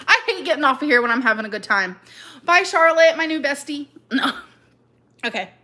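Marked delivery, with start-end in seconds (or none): none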